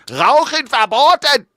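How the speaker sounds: high, loud, slow mock shout; German command. Host reprising the German sign joke, shouted command. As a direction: bark out the prohibition with full force. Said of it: "you could tell at the German".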